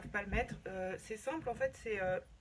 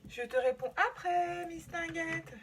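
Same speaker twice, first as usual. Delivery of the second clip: high, slow sing-song reply; bright sing-song; 8 semitones higher, 6 dB louder, slower than usual. Real, not acted. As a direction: call out sweetly to the client. Replies to a client's message.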